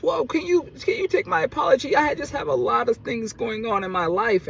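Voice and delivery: very high, mock pleading voice; apologizing neighbor. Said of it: "she finally apologized to me".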